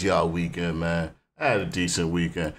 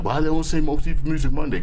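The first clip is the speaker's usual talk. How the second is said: higher-pitched, quick baffled question; puzzled self-quote; 6 semitones higher, 4 dB louder, faster than usual. Retold or imitated. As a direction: ask it fast and high, as if baffled. Quoting his own puzzled reaction.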